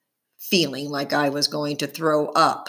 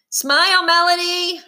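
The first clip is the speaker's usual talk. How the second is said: high, louder call across the room; 12 semitones higher, 4 dB louder than usual. Acted. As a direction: call out to get her attention. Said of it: "called a guy with a baseball cap".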